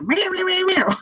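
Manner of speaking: high, insistent cat meows; sassy cat. Retold or imitated. Cat demands that owner roll over, sassy meowing. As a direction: imitate a cat talking back. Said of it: "she will sass me".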